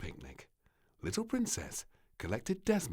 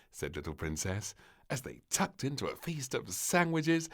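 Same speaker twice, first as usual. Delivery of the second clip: usual speaking voice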